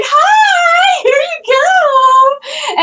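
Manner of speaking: very high, drawn-out singsong greeting; sugary nice. Retold or imitated. Acting all nice to the pregnant wife, sugary high singsong. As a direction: gush with exaggerated customer-service sweetness. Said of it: "all nice to her".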